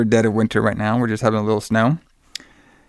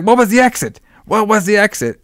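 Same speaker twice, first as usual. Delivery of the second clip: much higher, pressing urgency; urgent question; 9 semitones higher than usual. Retold or imitated. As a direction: demand it fast, worried. Frantic caller demands exit number.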